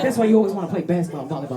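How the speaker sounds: rattled off fast; rapid accusation. Retold or imitated. Ex-boyfriend accusing her, fast rattled line. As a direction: fire it out quickly. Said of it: "He's like".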